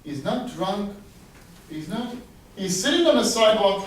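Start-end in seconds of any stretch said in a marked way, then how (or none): none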